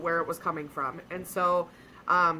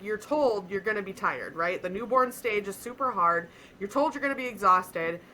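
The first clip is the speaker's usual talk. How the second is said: ordinary talk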